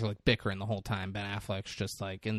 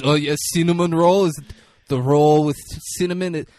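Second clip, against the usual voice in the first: loud, high, incredulous imitation of a boss; sarcastic boss; 5 semitones higher, 9 dB louder than usual. Boss questioning henchman about jacket stain, loud mock disbelief. Acting out boss's sarcastic tirade.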